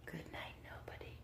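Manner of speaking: breathy whispered goodnight. Acted. Teacher whispering to sleepy children. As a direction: drop to a hush for this one. Whispered line in bedtime read.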